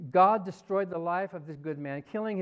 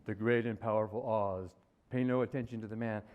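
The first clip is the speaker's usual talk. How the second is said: low, booming-style mock wizard proclamation; 8 semitones lower than usual. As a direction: drop your voice low and play the all-powerful wizard. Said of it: "That sounds kind of like".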